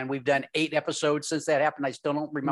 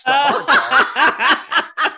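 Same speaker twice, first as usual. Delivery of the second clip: laughing; 9 semitones higher, 11 dB louder, much slower than usual. Real, not acted. Laughing while speaking.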